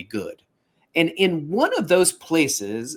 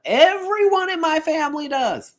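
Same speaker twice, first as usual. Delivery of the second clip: mock exclamation; 4 dB louder than usual. Real, not acted. Host teasing about the whole family's TV habit, mock exclamation. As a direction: exclaim it high, stretching the words out.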